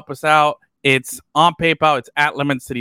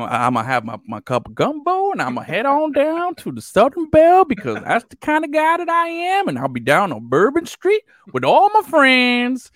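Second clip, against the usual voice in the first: put-on Southern Cajun accent; 7 semitones higher than usual. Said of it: "Oh yeah, full Cajun".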